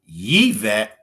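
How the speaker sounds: "Yvette" begun with a Y sound, Yee-vet; corrected pronunciation. Shown as a demonstration. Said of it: "My name is pronounced".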